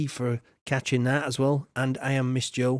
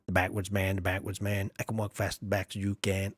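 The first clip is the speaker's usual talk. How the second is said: lower, quieter chant of the backwards man; a low, murmured movie quote; quoting the backwards man; 6 semitones lower, 5 dB quieter than usual. Retold or imitated. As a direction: imitate the film line low and soft. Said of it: "reference to the Tom Green film".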